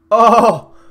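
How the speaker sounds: high, booming "oh"; loud exclamation. Real, not acted. Gamer reacting to the action, loud outcry. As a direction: burst out with a big reaction.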